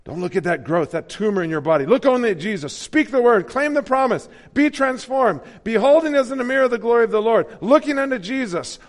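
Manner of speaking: loud, high preacher imitation without accent; Chris Oyokolomi impression. Mocking a Nigerian prosperity preacher, loud and high. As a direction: ham up the faith preacher. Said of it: "an accent for the next two".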